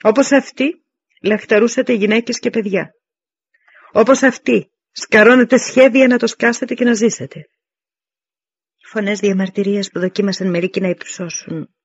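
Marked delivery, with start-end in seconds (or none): 0.0-7.5 higher, louder, hammering public accusation. Acted. Dienekes likens his soldiers to the runaways, loudly accusing. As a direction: throw the charge at the troops, raised voice.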